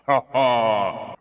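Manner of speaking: big loud mocking laugh of a giant; booming giant laugh. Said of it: "so loud it shook the ground".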